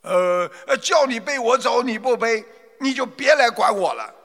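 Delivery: angry. Acted. Tired merchant angrily snaps at the herb picker. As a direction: scold him for not carrying you earlier. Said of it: "他便生气地说".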